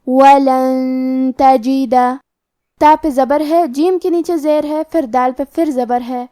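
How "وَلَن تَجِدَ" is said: وَلَن تَجِدَ with the nun hidden in a held nasal hum before تا; slow tajweed, nasal nun in وَلَن; nasalized ghunnah. Shown as a demonstration. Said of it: "ہم یہ حکم کو غنہ کے ساتھ ادا کریں گے".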